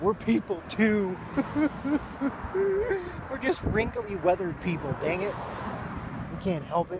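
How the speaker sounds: high, loud, laughing mock protest; mock indignant plea. Telling young viewers to be nice to old people, mock indignant. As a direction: ham up the hurt old man.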